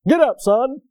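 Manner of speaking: dad with no sympathy; gruff dad. Retold or imitated. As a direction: bark it like an impatient father. Gruff dad voiced in story.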